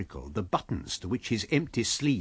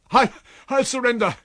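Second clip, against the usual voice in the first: high, loud cry of surrender; 12 semitones higher, 10 dB louder than usual. A narrator's reading. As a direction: stammer it out loudly. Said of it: "cried the stranger".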